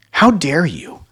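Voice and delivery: high, loud imitation of indignation; hammed-up outraged narcissist; mock narcissist. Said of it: "something a lot of narcissists like to say".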